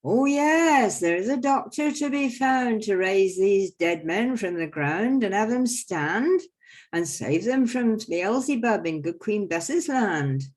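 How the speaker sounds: high, loud, slow mock-grand doctor; the doctor's answer. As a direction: announce yourself like a showman quack. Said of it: "I go".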